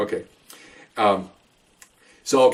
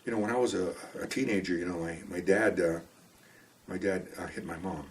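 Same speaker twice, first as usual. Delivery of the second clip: soft, hesitant imitation of a reluctant speaker; subdued retelling; 4 semitones lower, 13 dB quieter than usual. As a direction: trail down softer with halting pauses. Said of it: "And she's like".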